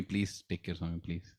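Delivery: low, hushed, pleading whisper-like voice; soft begging. Retold or imitated. Devotee quietly begging Swami to help someone, hushed plea. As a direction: beg under your breath.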